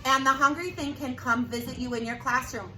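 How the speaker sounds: plain reading voice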